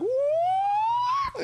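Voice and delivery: high hooting ape call; gibbon call. Retold or imitated. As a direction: whoop like a gibbon. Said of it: "'cause they go like".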